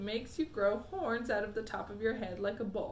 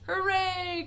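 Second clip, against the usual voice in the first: higher, louder cheer; 9 semitones higher, 7 dB louder than usual. A narrator's reading. Wonka cheering the boy's safe arrival. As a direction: cry out in delight. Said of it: "cried Mister Wonka".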